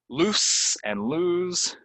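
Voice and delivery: Loose and lose drawn out high and slow; slow careful contrast. Shown as a demonstration. Teacher presenting loose and lose slowly for contrast. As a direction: stretch both words slowly. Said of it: "This one sounds like a s".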